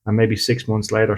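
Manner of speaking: ordinary delivery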